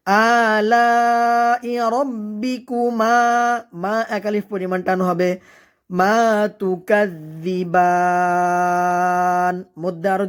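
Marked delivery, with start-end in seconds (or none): 0.1-3.2 "আলা" held very long, then slow chant; four-alif elongation. Shown as a demonstration. Teacher showing learners "আলা" with a long-held vowel. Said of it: "চার আলিফ পরিমাণ টেনে পড়তে হবে". 6.0-9.5 "তুকাযযিবান" chanted, last vowel held very long; three-alif elongation. Shown as a demonstration. Teacher showing learners "তুকাযযিবান" with a long-held ending. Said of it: "তিন আলিফ পরিমাণ টান হবে".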